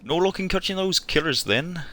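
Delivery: a hint of a Hot Fuzz villager; West Country accent. Gamer quoting Hot Fuzz locals, light West Country accent. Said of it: "them in Hot Fuzz".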